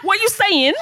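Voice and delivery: high-pitched, exaggerated male twang; mock twangy man. Shown as a demonstration. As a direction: imitate a guy with a silly twang, amused. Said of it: "got a bit of a twang like this".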